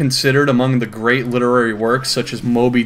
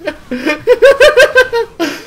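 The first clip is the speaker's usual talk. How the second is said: high laugh; 4 dB louder than usual. Real, not acted. Cackling at the story.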